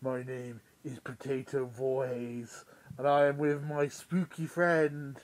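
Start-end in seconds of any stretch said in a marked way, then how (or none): none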